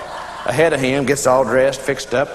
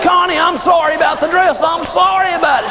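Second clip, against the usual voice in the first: hammed-up sorry husband; husband pleading with his wife about the dress; mock husband apology; 9 semitones higher than usual.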